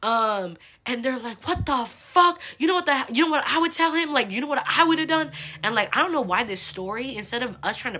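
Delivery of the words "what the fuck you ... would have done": high, loud indignant disbelief; outraged friends, imitated. Girls hearing about the dad's behavior, acted indignation. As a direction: react scandalized on a friend's behalf.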